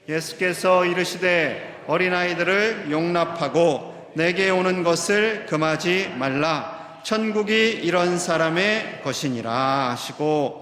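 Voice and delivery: slow, loud, measured recitation of the verse; deliberate, weighty reading of scripture; solemn scripture reading. Acted. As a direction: read the Bible verse aloud with the congregation, slowly. Congregation reads scripture aloud.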